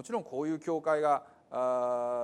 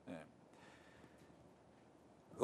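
ordinary delivery

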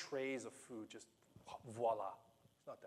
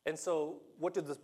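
usual speaking voice

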